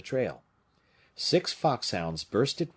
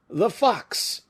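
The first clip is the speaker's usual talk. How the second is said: high, loud joyful shout; delighted hunters' shout; glad cry; 13 semitones higher, 7 dB louder than usual. A narrator's reading. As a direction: cry out like hunters spotting prey. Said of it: "cried the Bretons with a glad surprise".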